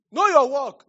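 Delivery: loud emphatic repeat. Real, not acted. A preacher shouting advice to the congregation, loud and high.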